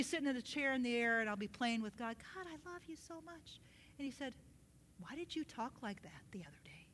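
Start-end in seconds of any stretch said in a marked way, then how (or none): 2.3-3.6 very soft, higher-pitched endearment; soft, adoring little prayer; tender murmur. Retold or imitated. Acts out playing with God. 5.0-6.9 whispered, lower-pitched question; God softly questioning a child; hushed gentle reproof. Retold or imitated. Voices God correcting gently.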